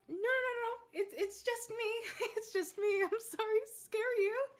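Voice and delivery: very high, loud woman's voice; calling out reassurance. Acted. Mermaid to the people she scared, calling out. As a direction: call out high and loud to calm them.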